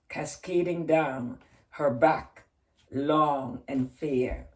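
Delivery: dramatic poem reading, slow in places. Acted. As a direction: tell the dark tale with rising tension. Reads a long poem.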